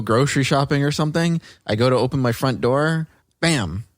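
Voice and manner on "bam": normal talking voice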